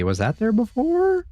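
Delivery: sudden high-pitched suspicious question; startled question. Real, not acted. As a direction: jump up high in alarm. Notices something maybe changed.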